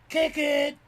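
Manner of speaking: high, loud cheer of "Kick it!"; excited shout. Real, not acted. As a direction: yell it out in excitement. Cheering the pet on.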